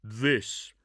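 clipped warrior answer; gruff space marine. Acted. Space Marine's curt reply.

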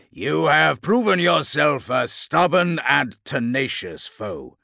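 loud, slow, high-pitched solemn tribute; monstrous warlord, growing serious. A narrator's reading. Chaos lord honouring a tenacious foe, serious. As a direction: proclaim it gravely as a villain lord. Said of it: "his tone growing serious".

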